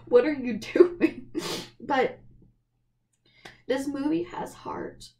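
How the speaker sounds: usual speaking voice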